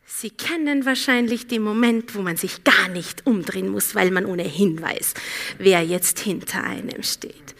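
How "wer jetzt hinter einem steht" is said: whispering. Real, not acted. Suspenseful whispered aside.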